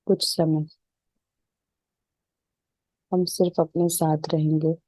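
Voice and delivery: even, unhurried meditation voice with little pitch movement; settled, level relaxation-session delivery; guided meditation. Real, not acted. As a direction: guide them calmly into stillness, leaving space between phrases.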